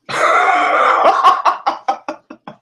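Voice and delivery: big high cackle of disbelief; loud mocking laugh. Real, not acted. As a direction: burst out laughing at him. Mocking the lawyer's page.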